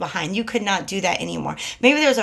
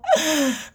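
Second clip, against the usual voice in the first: excited gasp; 6 semitones higher than usual. Real, not acted. Thrilled by the card.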